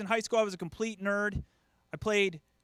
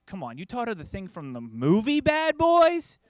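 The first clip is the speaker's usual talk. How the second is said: exasperated wife; 3 semitones higher, 7 dB louder than usual. Retold or imitated. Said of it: "my wife's like".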